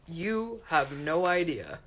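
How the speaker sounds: slow, knowing drawl; rueful understatement. A narrator's reading. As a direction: let the weariness show. Todd's rueful reply.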